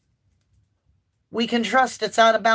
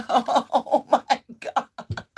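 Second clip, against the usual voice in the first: laughing disbelief; 3 semitones higher, much slower than usual. Real, not acted. Reader laughing in shock at the cards drawn.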